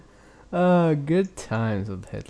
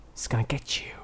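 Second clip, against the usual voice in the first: whispered mock threat; spooky whisper; 8 dB quieter than usual. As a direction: whisper it like a ghost story. Spooky warning after joke.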